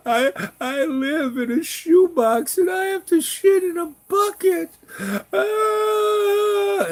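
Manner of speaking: high, loud, slow wailing voice breaking into a sob; crying woman. Retold or imitated. Crying woman telling of her shoebox flat, high loud wail. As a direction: imitate her crying out her misery. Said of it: "She starts crying".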